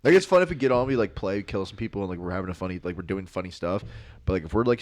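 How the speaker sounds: ordinary talk, nothing marked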